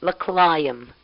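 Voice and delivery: put-on child's insistent "l'chaim, l'chaim"; child insisting. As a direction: imitate the kid stubbornly insisting. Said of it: "she says".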